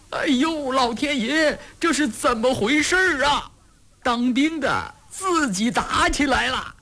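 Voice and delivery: very high-pitched wail of dismay; weird shrieking. A narrator's reading. Bystander sees soldiers fighting each other, shrieking oddly. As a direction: yelp it like a bystander in a panic. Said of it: "有的在怪叫".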